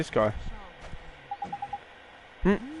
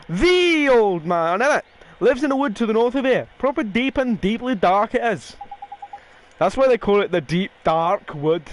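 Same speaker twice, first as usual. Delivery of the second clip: a cheeky Welsh fairy, pitched higher; lightly voiced fairy sidekick; 6 semitones higher, 3 dB louder than usual. Fairy companion ordering the boy to mend hearts, cheeky. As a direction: play the bossy little companion, a touch slowed at the end. Reading Drippy's lines aloud.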